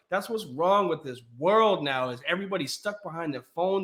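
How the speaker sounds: normal talking voice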